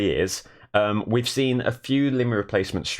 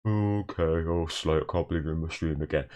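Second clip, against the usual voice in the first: lightly voiced, lower and softer chat regular; a hint of a weary Scottish regular greeting you; Staggies impression; 3 semitones lower, 4 dB quieter than usual. Streamer imitating a chat regular, lightly lower and softer. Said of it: "The closest I get is impersonating Staggies".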